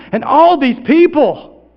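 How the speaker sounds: loud, high, slow wonder; big, drawn-out astonishment; amazed. Acted. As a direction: marvel at the crowd, stretching the words. Amazed at seeing the crowd.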